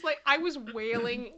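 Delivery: ordinary delivery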